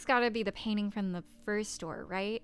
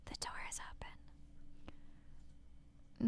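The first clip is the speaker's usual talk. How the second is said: hushed, unvoiced whisper of a horror game line; Mortuary Assistant voice; 12 dB quieter, much faster than usual. Streamer reading game text in the horror-game whisper. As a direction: breathe it like the creepy game voice. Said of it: "I always read it in the Mortuary Assistant voice".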